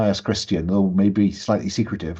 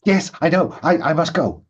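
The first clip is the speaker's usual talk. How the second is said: higher, hurried-to-leave reply, lightly played; flustered woman; 7 semitones higher than usual. Woman is called away mid-talk, mildly flustered. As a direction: answer the call and excuse yourself, a touch flustered.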